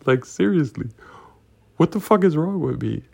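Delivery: loud, high exasperation at yourself; incredulous outburst at your own stupidity; exasperated self-reproach. Real, not acted. Exasperated at himself after the reveal.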